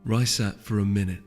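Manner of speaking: ordinary delivery, nothing marked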